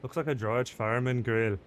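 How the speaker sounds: playful Scottish-style put-on accent. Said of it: "All right, we're back".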